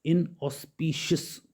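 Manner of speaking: इनऑस्पिशियस said slowly, in-os-pi-shus, rising at the end; slow classroom repeat of इनऑस्पिशियस; careful pronunciation. Shown as a demonstration. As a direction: spell out इनऑस्पिशियस syllable by syllable. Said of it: "उसे कहते हैं इनऑस्पिशियस".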